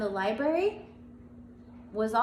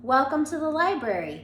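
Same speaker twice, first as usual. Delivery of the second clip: hearty welcome; 5 semitones higher, 5 dB louder than usual. A narrator's reading. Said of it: "Mister Fish exclaimed".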